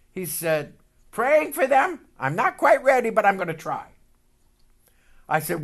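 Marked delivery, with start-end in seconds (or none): 1.1-3.9 higher, louder hesitant brother; reluctant brother. Retold or imitated. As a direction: push back half-willingly. Brother reluctant about praying.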